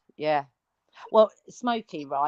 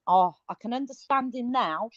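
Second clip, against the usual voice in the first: normal talking voice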